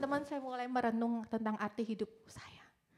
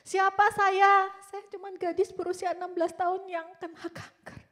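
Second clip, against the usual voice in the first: anguished protest; 7 semitones higher, 9 dB louder than usual. Retold or imitated. Sixteen-year-old with cancer asking who she is, anguished protest. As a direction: burst out, then ease slightly.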